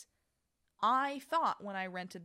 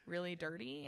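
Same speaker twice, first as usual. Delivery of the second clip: normal talking voice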